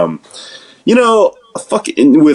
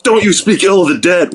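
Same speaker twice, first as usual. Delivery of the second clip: higher, prim indignant scold; mock scolding; 9 semitones higher than usual. Someone scolding jokers about a dead politician, in a higher mock-indignant scold. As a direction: wag a finger at people joking about the dead. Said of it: "you're not like".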